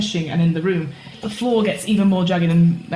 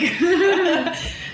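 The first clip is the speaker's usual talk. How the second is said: high laugh; 12 semitones higher than usual. Real, not acted. GM laughs at Gwen.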